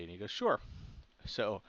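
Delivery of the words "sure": light, high, carefree reply; breezy sure. Retold or imitated. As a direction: toss it off breezily, pitched up. Dad casually agrees to open can.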